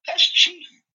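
high, loud indignant cry; mock outraged protest. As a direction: blurt it up high, scandalized. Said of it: "I was like".

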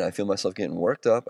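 ordinary talk, nothing marked

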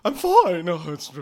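high, flustered denial; jittery high voice; nervous Smithy. Acted. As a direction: stammer out you're fine.

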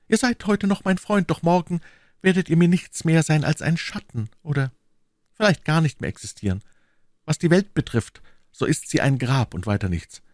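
lightly voiced world-weary musketeer; a hint of the would-be monk; Aramis. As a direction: renounce the world with mild solemnity.

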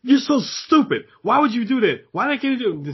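high-pitched, incredulous mock yell; retold screaming. Rude customer berating the cashier, mock screaming. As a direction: imitate an outraged customer berating someone, pitch way up. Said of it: "he's screaming".

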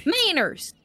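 a hint of a strict governess; lightly voiced prim scolding. Player scolding, repeated.

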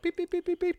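beeping noise. Retold or imitated. Imitating arrow explosive beeping.

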